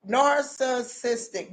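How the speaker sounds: "narcissistic" drawn out slowly, syllable by syllable; labored big word. Shown as a demonstration. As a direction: pick slowly through each part of "narcissistic". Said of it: "I don't know nothing about this".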